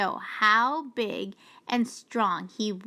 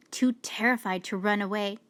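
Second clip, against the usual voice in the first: ordinary delivery, nothing marked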